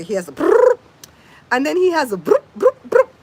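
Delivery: loud rising brrr of a power tool; imitated drill buzz. Telling viewers how the helper changed the tire, loud buzzing. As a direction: buzz like a torque gun, up high.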